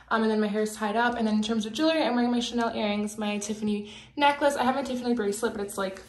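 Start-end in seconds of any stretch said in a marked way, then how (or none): none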